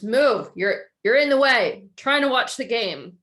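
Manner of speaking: irritated father snapping; annoyed dad. Retold or imitated. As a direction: shoo the kid away from the TV. Dad dismissing child.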